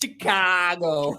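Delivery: slow, drawn-out Chicago accent on "Chicago". Shown as a demonstration. Asked by co-host to say Chicago, slow Chicago accent. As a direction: stretch "Chicago" out, thick Chicago style. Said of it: "Say Chicago in your most Chicagoan accent".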